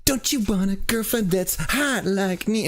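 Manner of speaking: mock seductive influencer voice; mocking a flirty influencer. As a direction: parody a TikTok cosplayer's sultry pitch. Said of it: "she was just doing".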